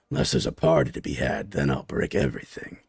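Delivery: low hungry monster voice; deep reptilian brute. Acted. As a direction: drop way down into a lumbering beast. Creature's gleeful threat.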